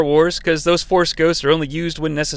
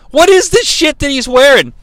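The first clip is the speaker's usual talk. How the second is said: exasperated outburst; 8 semitones higher, 4 dB louder than usual. Real, not acted. Ranting about the costume.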